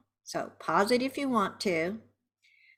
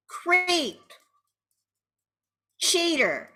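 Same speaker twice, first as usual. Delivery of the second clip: higher-pitched angry outburst; angry venting; 6 semitones higher, 3 dB louder than usual. Shown as a demonstration. Betrayed partner to the cheater, angry accusations. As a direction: fling each accusation out on its own.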